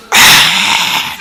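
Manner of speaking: drawn-out groan of the losing side; mock dismay. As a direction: groan as if your team conceded. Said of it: "골인을 먹은 사람들은". (골 먹은 사람의 탄식 흉내)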